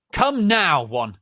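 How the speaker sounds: high, loud stern command; strict teacher yelling; yelled order. Acted. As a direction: bark the order at them. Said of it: "Preacher McGonagall yielded".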